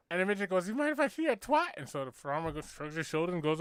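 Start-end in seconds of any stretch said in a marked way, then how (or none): 0.7-1.7 higher, put-on impeded speech; a dreamy little man with a speech impediment. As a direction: joke teller voicing the little man admiring the horse. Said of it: "he's got a fucking speech impediment".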